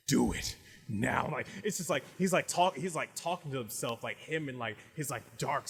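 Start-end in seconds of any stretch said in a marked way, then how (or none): 0.1-1.3 sadistic inner demon. Acted. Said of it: "way more, like, cocky and sadistic than him".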